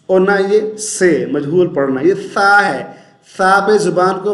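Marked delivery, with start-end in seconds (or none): none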